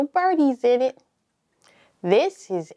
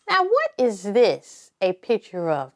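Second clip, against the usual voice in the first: ordinary talk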